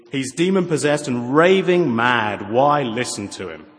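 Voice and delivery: scornful accusation. Acted. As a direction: dismiss him as a madman. Scornful accusers quoted in verse.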